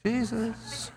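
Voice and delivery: soft, coaxing call of a name; soft gentle wake-up call; gentle nudge. Shown as a demonstration. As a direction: nudge a sleeper awake softly. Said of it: "I doubt that it was a gentle voiced nudge".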